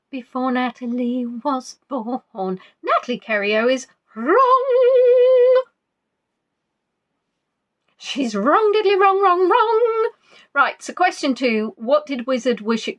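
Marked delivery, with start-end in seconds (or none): 2.8-10.1 high, loud sing-song "wrong, wrong"; gloating teasing. Acted. Host mocks Natalie's wrong quiz answer, loud high gloating. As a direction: crow over her mistake.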